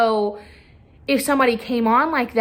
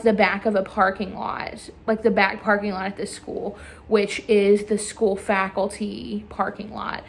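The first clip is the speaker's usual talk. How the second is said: ordinary talk, nothing marked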